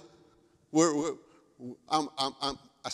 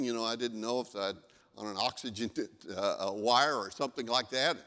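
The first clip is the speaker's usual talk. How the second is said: ordinary delivery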